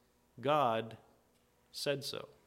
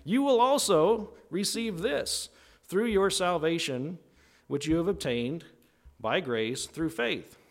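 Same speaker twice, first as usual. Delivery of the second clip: much higher and louder, salesman building hype then settling; TV commercial pitchman; 4 semitones higher, 3 dB louder than usual. Retold or imitated. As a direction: sell it like an infomercial host. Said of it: "Paul is here, is like".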